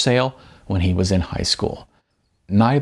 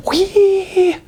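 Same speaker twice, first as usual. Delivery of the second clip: much higher, playful little cry of joy; gleeful whee; 4 dB louder than usual. Acted. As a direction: let out a happy squeal while jumping. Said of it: "softly calling out".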